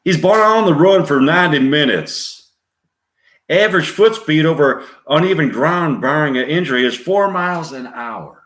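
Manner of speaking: loud, high-pitched Southern lawman; forceful Southern-accented police orders; Tommy Lee Jones Southern accent. Acted. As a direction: bark a briefing in a Southern accent. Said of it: "orders to his men with a Southern accent".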